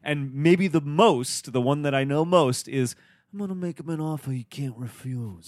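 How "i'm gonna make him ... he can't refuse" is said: Godfather impression. Quoting the famous Godfather line.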